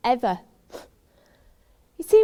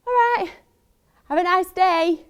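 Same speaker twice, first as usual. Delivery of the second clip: much higher-pitched, forced casual phone voice; awkward sign-off; 6 semitones higher than usual. Retold or imitated. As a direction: put on a high, breezy goodbye to escape the call.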